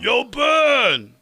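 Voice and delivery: high, loud call; action figure catchphrase. Pretend. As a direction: shout it like a talking toy. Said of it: "what your action figure should say".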